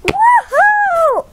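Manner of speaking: very high, sustained woohoo; jubilant whoop. Pretend. Toy cheers before thanking the giant, jubilant whoop. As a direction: let out a high playful cheer. Toy celebrates in pretend play.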